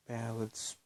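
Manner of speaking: ordinary delivery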